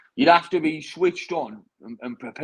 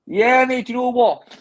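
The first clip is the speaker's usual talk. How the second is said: excited outburst; 10 semitones higher, 8 dB louder, slower than usual. Real, not acted. Excited reply about first fight.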